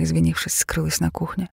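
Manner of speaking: usual speaking voice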